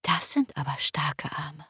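verführerisch ins Ohr geflüstert; leises, verlockendes Flüstern. Acted. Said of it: "flüsterte sie mir ins Ohr". (verführerisch geflüstert)

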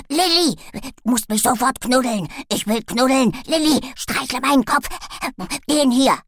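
loud, demanding little dog; yapping in a frenzy. A narrator's reading. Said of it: "kläffte sich richtig in Rage".

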